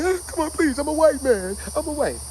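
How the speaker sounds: desperate plea. Retold or imitated. Begging soldiers not to shoot.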